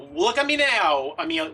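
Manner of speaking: mock triumphant call-out; triumphant taunt. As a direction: crow it at her in triumph. Triumphant taunt at old rival.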